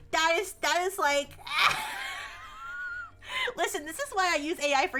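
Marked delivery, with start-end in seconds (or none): 1.3-3.5 very high, long squeal of laughter; high laugh trailing downward. Real, not acted. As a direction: let out a helpless shrieking laugh. Cracking up at the absurdity.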